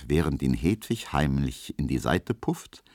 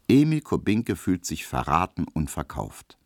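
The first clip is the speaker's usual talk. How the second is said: ordinary delivery, nothing marked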